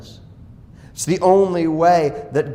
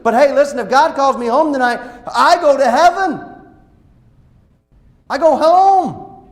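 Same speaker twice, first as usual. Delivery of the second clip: full-force preaching; 9 semitones higher, 6 dB louder than usual. Acted. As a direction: proclaim it with triumph.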